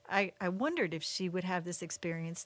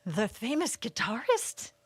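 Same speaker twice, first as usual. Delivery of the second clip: higher, slow, wide-eyed wonder; amazed gasp; 5 semitones higher, slower than usual. Retold or imitated. Imitating mother's astonishment at the guitarist, higher and slow. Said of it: "she was just like".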